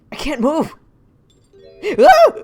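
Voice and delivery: high, loud, startled outburst; acted panic. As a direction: cry out on finding yourself trapped. Acted character waking up in handcuffs.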